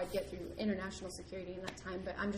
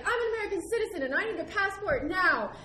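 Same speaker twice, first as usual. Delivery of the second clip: high, loud, pushing; insist, a bit frantic; urgent demand; 11 semitones higher, 9 dB louder than usual. Retold or imitated. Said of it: "I was like".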